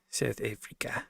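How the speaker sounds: put-on soft, breathy South African accent; hushed practice of a South African accent; whispered South African accent. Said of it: "Something like that".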